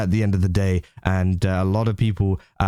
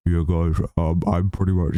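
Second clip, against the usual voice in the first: mock deep voice; 4 semitones lower than usual. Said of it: "trying to put on a deep voice".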